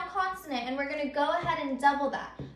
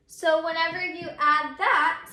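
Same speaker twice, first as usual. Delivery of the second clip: usual speaking voice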